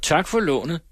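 mildly cheery, mocking goodbye; cheerful. A narrator's reading. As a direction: toss it off lightly as he walks away. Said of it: "med et muntert".

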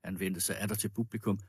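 ordinary delivery, nothing marked

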